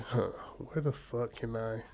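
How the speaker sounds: hushed, puzzled muttering to himself; half-voiced mumble while searching; quiet muttering. Old man searching his room, muttering under his breath.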